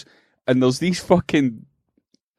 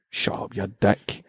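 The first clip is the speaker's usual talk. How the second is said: put-on low, soft and slow sulky kid; sulky muttered insult; 6 semitones lower, 6 dB quieter, slower than usual. Wee boy muttering an insult at the grown man, low and slow.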